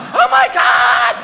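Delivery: slow, loud terrified oh my God; drawn-out panic cry. Retold or imitated. Flying upside down out of control, slow loud panic. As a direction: hold each word as you hurtle along. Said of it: "So I'm just going".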